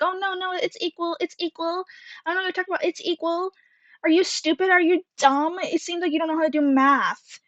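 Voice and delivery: high, whiny mock voice insisting it's equal; mocking the girl. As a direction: ham up a snotty classmate playing dumb. Girl denies, mocked in retelling.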